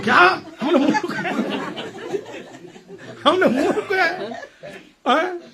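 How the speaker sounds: mock-outraged squeal of insulted scholars; offended pandits. Pandits to Kalidas, indignant high laughing protest.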